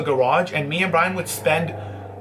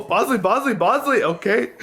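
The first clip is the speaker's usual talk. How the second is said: laughing call; 7 semitones higher than usual. Real, not acted. Laughing while calling the dog.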